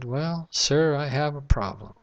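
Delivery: usual speaking voice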